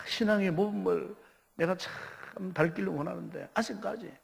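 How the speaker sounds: ordinary talk, nothing marked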